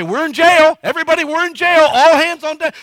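high, very loud yell to the crew; urgent crew shout. Retold or imitated. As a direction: holler it across the job site like an emergency. Acting out urgent calls on concrete crew.

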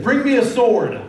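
loud high command for a sword; commanding call. Retold or imitated. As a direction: ordonne fort, plus aigu. King commands in retold story.

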